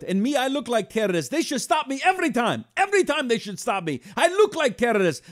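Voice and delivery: put-on high Iranian accent, animated; Persian accent. Owner joking about airport security, Iranian accent. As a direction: insist on being stopped, Persian accent. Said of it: "I went to the accent for a minute".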